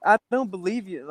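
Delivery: high, light disbelieving voice; mock disbelief. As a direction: play it like you can't believe it.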